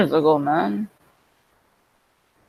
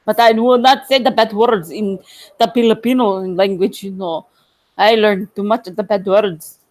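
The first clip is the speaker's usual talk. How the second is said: put-on Filipino accent. Streamer admitting knowing only bad words, Filipino accent. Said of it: "And my accent probably drunk".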